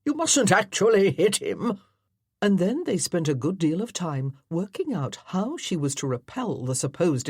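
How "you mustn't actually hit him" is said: loud parade-ground colonel; commanding colonel. Acted. Colonel directing his amateur actors, loudly commanding. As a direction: bark orders like a drill officer.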